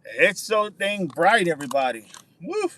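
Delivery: ordinary talk, nothing marked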